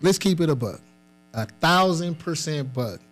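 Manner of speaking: ordinary talk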